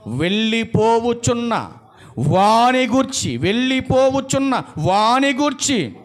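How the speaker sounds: high, slow, chanting-like scripture delivery; forceful preaching. Real, not acted. Preacher reading verse to congregation about whom to lament, forceful preaching. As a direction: draw out each phrase of the verse.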